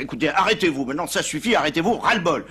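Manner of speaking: high, loud fed-up outburst; exasperated pope. Acted. Exasperated pope yelling at the taxi driver to stop. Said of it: "le Saint-Père est excédé".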